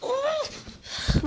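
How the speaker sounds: high-pitched cry of frustration; exasperated groan. Real, not acted. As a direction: let out a sharp, high groan. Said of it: "Vallahi sinirlendim". (sinirli, tiz bir of çekme)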